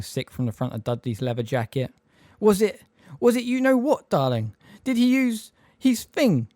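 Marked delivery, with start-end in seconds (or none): 2.4-6.5 quivering. A narrator's reading. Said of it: "in a quivering voice".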